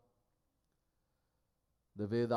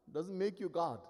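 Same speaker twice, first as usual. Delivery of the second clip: ordinary talk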